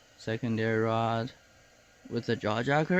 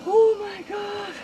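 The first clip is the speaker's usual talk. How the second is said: high, loud, thrilled cry; excited; 7 dB louder, slower than usual. Real, not acted. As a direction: burst out in delight. Fish hooked, big reaction.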